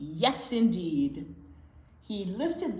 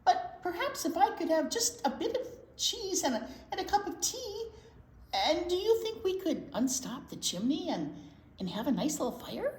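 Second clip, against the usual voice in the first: mouse voice; 9 semitones higher, faster than usual. Acted. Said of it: "said the mouse".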